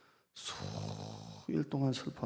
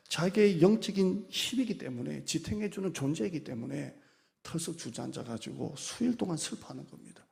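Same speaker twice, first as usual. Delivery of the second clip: raised, louder sermon climax; impassioned preaching; 6 semitones higher, 5 dB louder than usual. Acted. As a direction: drive the point home from the pulpit. Preaching at full force. (강단에서 열정적으로 외치는 설교)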